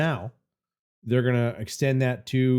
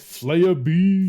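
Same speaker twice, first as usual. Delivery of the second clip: drawn out; 6 semitones higher, 7 dB louder, much slower than usual. Pretend. Savoring a funny username.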